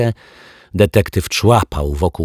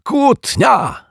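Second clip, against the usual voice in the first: very high, exultant exclamation; gleeful high yell; overjoyed cry; 4 dB louder than usual. A narrator's reading. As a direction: crow it with delight. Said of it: "wrzasnął uszczęśliwiony detektyw Pozytywka".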